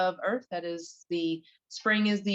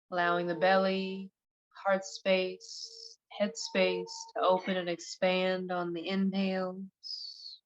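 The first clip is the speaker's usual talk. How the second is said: guided meditation. Acted. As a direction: ease them gently into relaxation, one unhurried phrase at a time.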